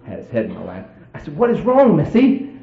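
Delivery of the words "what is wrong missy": high, loud questioning; retold husband's high-pitched question; imitated urgent question. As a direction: press her with a high, put-on urgency. Husband questioning his hysterical wife.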